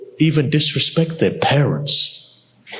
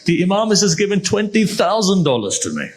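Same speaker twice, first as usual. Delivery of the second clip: high-pitched, mildly overwhelmed retelling; retold tearful amazement; 7 semitones higher than usual. Needy man overwhelmed by the Imam's gift, retold tearful amazement. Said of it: "he begins to cry".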